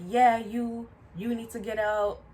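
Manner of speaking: loud, rude order with a pitch jump; rude yelling. Retold or imitated. Coworker singling out the maskless man, loud and rude. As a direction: point the man out loudly, mimicking a rude coworker. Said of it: "so rude and aggressive about it".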